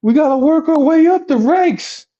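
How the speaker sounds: high-pitched mock pleading; urgent persuading. Friend justifying the girls to Rich, high urgent voice.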